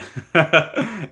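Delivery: a short high burst of laughter. Real, not acted. Cracking up at his own Aussie impression, high laugh.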